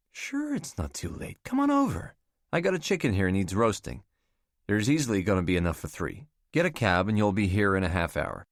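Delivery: hospitable woman. Acted. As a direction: wave the worry away, inviting. Catherine voiced inviting Alec.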